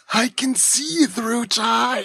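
high, slow, dazed Lisa voice; drawn out in a spaced-out child voice; Lisa quote voice. Retold or imitated. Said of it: "something we also quote in my house".

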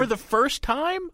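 high, drawn-out disbelieving question; slow, high-pitched incredulity; incredulous echo. Real, not acted. Challenges co-host's claim by echoing it.

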